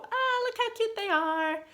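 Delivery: ordinary talk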